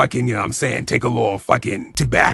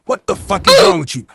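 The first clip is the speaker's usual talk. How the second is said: high, loud, incredulous scolding; mock outraged scolding; 5 dB louder than usual. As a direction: act out a high-pitched outraged telling-off. Acted scolding over the lost ball.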